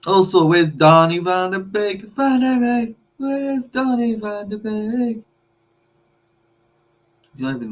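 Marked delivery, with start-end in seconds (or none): 2.1-5.2 mocking singsong. Mocking the absent rival player.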